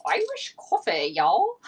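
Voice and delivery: lightly put-on Irish brogue, drawn out; mock Irish accent. To her live viewers, a playful light Irish accent. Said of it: "I had to talk like an Irish person".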